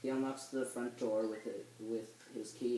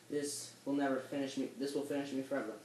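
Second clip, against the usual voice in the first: normal talking voice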